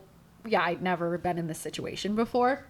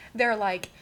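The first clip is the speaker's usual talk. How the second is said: normal talking voice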